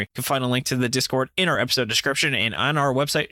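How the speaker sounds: ordinary delivery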